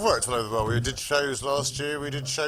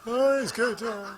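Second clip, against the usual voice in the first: put-on South African accent; 7 semitones higher than usual. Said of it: "this funny accent".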